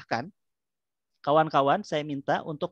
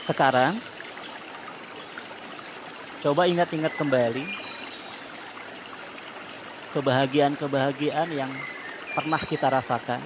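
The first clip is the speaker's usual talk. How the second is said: calm guided breathing voice; soothing spa whisper. Acted. Leads relaxation therapy.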